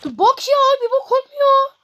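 high, loud exasperated outburst; exasperated yell. Real, not acted. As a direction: throw it at the game in fury. Oyun gitmiyor, isyan ediyor. (sinirli bağırış)

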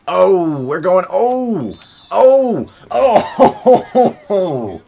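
high, loud rising shouts of joy; excited screaming. Real, not acted. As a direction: yell with delight at the big play. Highlight play, screaming excitement.

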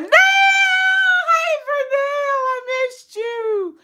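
squealing with delight at Vernell; excited shriek. Real, not acted. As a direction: shriek hello to a missed friend. Shrieks greeting to viewer.

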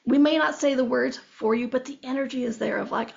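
usual speaking voice

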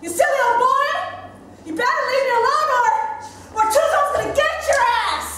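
high, loud, fast warning; telling him off. Acted. Little girl yelling at a pestering boy, loud fierce threat. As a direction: shout him down, all bravado.

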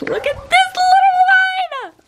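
very high, loud squeal of delight. Real, not acted. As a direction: squeal at the tiny puppy. Cooing at puppy.

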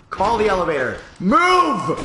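high, loud frantic yell; frantic shouted command; 1 panicked shout. Real, not acted. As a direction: scream at the elevator to hurry. Fleeing boss in panic.

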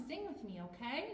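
usual speaking voice

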